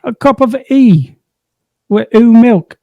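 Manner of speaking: high put-on British accent dropping the T's; mock British accent. Wife teasing husband about his accent, high mock British. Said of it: "Don't sound like that".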